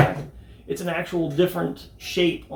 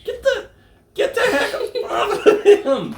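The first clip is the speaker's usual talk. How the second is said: high laughing shout; laughing yell; 6 dB louder than usual. Real, not acted. Toy fight, yelling.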